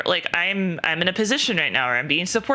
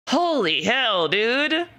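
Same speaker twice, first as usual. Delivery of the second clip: shocked; 6 semitones higher, much slower than usual. Real, not acted. Reacting to harassing text.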